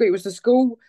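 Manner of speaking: usual speaking voice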